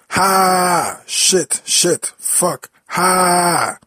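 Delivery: very loud, high-pitched cursing screams; furious screaming. Acted. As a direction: explode into screamed swearing, far above normal voice. Furious outburst after angry breathing.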